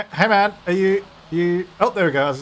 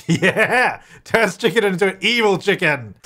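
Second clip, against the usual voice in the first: louder, higher, laughing through the words; laughing delight; 5 semitones higher, 3 dB louder than usual. Real, not acted. Gamer laughing as the chicken turns evil, loud delighted laughter. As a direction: cackle at the new evil chicken.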